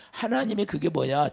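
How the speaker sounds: ordinary talk, nothing marked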